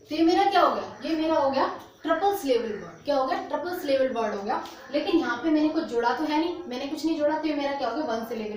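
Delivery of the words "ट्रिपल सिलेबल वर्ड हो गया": ordinary talk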